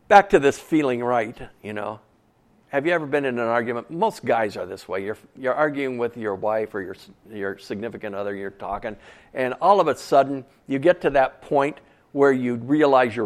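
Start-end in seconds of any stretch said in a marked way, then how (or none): none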